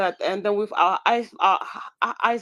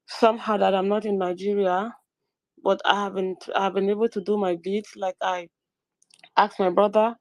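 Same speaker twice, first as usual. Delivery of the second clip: ordinary delivery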